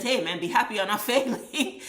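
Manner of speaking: teasing with a laugh breaking through; laughing tease. Real, not acted. Teasing the C student, laughing as the word failing comes.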